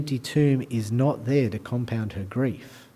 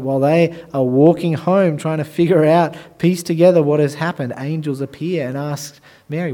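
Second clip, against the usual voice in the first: normal talking voice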